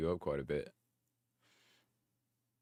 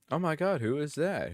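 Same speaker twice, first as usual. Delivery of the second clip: high, swooping fanboy gush; play-acted starstruck squeal of delight; mock awe; 7 semitones higher than usual. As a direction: gush in exaggerated admiration. Mock admiring himself, hyping up.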